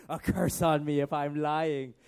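loud, slow mock oath; booming, drawn-out imitation; mocking quote. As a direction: throw the words back mockingly. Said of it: "Why would you say".

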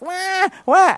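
high mock baby wail; baby's yelling cry. Tired parent mimicking a baby yelling at them, mock wail. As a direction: imitate a yelling newborn with high wahs. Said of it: "they start yelling at you".

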